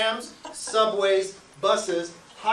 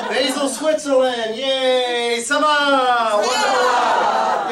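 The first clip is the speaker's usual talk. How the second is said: loud, drawn-out celebration; hammed-up slow, loud hurrah; mock cheering; 4 semitones higher, 7 dB louder, much slower than usual. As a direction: cheer big, stretching the words.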